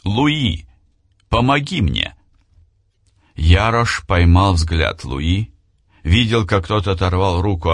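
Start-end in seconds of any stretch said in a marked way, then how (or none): 0.0-2.1 high, urgent cry for help; shouting. A narrator's reading. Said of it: "он крикнул".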